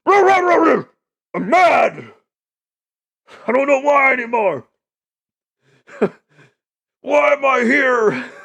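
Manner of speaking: high, loud mock grunting; hammed-up caveman noises; caveman grunts. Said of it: "you're like".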